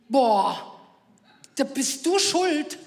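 put-on a drawn-out sulky teenage accusation, slowed down; whiny teen son. Mother imitates her son blaming her for the wrong answer, sulky whine.

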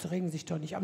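soothing nurse. Retold or imitated.

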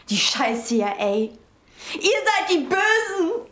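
high, loud and furious, last words slow; angry outburst. Acted. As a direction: shout the accusation in rage. Daughter accuses parents of lying.

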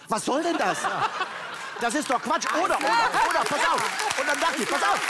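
viel höher und lauter, immer aufgebrachter; sich aufregend. Real, not acted. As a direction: steiger dich richtig rein. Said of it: "du regst dich ja total auf". (Comedian regt sich über Stöcker auf, hoch und lauter)

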